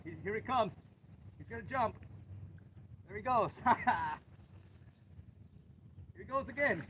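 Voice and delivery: tense play-by-play while reeling; fighting a fish. Real, not acted. Fish pulling line, still fighting.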